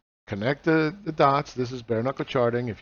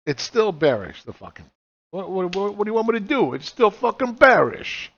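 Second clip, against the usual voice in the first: mock exasperated rant; 5 semitones higher, 5 dB louder than usual. Trader yelling at a chart that stays bearish, exasperated. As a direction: play a frustrated trader losing patience. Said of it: "So then you're gonna say".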